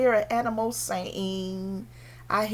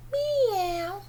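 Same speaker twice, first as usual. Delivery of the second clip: high, drawn-out kitty meow; high meow sliding downward; cat meow; 9 semitones higher than usual. Acted. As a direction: mew like a little cat. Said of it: "Kitty cat".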